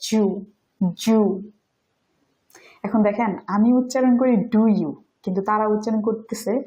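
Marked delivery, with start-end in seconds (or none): 0.1-1.2 "জু" said as a single "joo", both words squeezed into one; fully compressed "জু". Shown as a demonstration. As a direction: squeeze "do you" down to "জু". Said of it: "compress করে ওরা উচ্চারণ করে".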